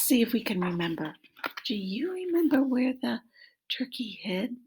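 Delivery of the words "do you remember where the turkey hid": usual speaking voice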